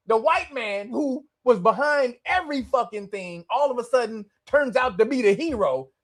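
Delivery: ordinary talk, nothing marked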